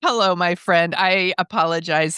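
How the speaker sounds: normal talking voice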